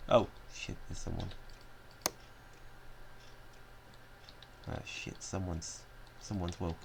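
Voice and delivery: breathy whispered panic; hushed whisper. Real, not acted. Sneaking burglar whispering in alarm. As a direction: whisper so the guards don't hear. Sneaking, spotted someone waking.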